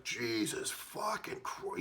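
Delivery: slow, drawn-out horrified curse; freaking out. Retold or imitated. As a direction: drag the curse out in stoned shock. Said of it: "started freaking out".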